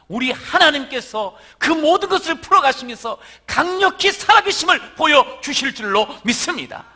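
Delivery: loud, high climax of a sermon; a pastor lifting the congregation to a shouted climax; full-force preaching. Acted. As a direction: preach it out with rising fervour, almost shouting.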